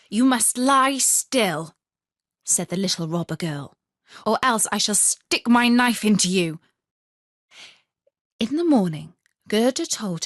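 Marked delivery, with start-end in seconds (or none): 0.1-1.8 slow, loud threatening order; stern command. Acted. Robber girl orders Gerda to lie still, slow and loud. 4.3-6.6 threat. Acted. Robber girl threatens.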